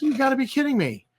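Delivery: fast, high, incredulous. Retold or imitated. Retelling his reaction to the burly orcs, high and fast. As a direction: toss it off high and quick in disbelief. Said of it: "And I was like".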